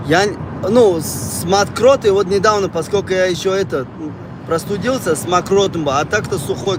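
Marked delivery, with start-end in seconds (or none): none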